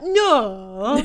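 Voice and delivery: loud, exaggerated Bradford "No"; long Bradford vowel on "No"; Bradford accent. Imitating housemate from Bradford to friends, hammed-up Bradford accent. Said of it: "She's like".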